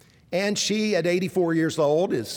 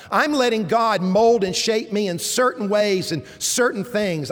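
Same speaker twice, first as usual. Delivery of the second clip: louder, higher preaching build; full-force preaching; 5 semitones higher, 4 dB louder than usual. Acted. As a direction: drive it home to the congregation.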